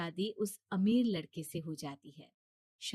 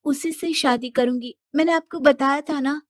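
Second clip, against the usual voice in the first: put-on louder young woman's pleading protest; Shilpi protesting; 6 semitones higher, 8 dB louder than usual. Daughter to her father about marriage, louder pleading protest. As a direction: push back at father, slightly higher.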